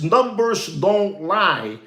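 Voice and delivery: slow quoted line. Retold or imitated. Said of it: "he says".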